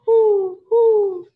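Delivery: high, loud hooting owl call. Acted. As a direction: hoot the words like an owl to the kids. Owl hoots in picture book.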